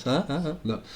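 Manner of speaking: ordinary talk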